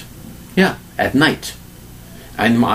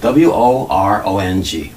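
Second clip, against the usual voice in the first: spelling out; 3 semitones lower, slower than usual. Shown as a demonstration. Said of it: "Take a look".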